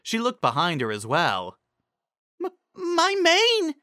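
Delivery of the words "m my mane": much higher, stammering, slow cry of alarm; panicking over her sudden mane; shocked exclamation. A narrator's reading. Carrot Top sees her mane exploding, alarmed cry. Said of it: "she exclaimed".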